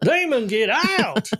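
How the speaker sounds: high, drawn-out mock exorcism cry; screaming pulpit preacher. Husband teasing wife as a pulpit screamer, mock preacher cry. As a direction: cast out a demon, hammed up. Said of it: "up on a pulpit now screaming at everybody".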